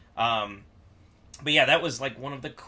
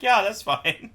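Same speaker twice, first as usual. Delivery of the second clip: higher, louder easygoing reply; imitated easy yes, pitched up; breezy agreement; 8 semitones higher, 6 dB louder, slower than usual. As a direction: toss off a cheerful okay, imitating bar staff. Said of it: "they're like".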